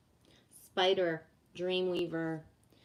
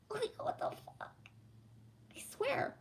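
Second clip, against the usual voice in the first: high, hushed whisper of disbelief; exasperated whisper; 9 dB quieter than usual. Real, not acted. Complaining to chat about being misjudged, whispered. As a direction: whisper it like you can't believe it.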